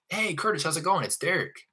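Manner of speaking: high, breezy sales-call hello; familiar greeting. Shown as a demonstration. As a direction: greet a stranger like a pal, pitch up. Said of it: "As funny as it sounds".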